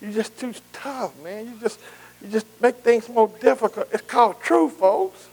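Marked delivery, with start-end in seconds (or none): 0.0-3.9 softly mimicked complainer; grumbling critics. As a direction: drop the volume right down to mimic critics' complaints. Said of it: "people say".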